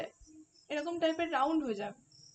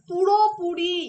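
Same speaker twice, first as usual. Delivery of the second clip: পুরোপুরি said much louder; stress on পুরোপুরি; 8 dB louder, slower than usual. Shown as a demonstration. Teacher drilling the key word, পুরোপুরি loudly stressed. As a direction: lean hard on পুরোপুরি. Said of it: "এই শব্দটার উপরে আমি জোর দিতে চাই".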